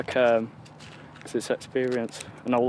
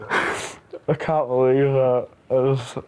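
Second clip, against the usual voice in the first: slow, laughing disbelief; 4 dB louder, slower than usual. Real, not acted. Laughing in disbelief after chaos.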